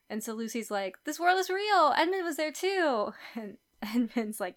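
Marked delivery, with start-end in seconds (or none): 1.0-3.1 high, emphatic retold protest; hammed-up earnest protest; imitated insistence. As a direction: act out a child insisting, pitched up. Voices Lucy insisting she was truthful.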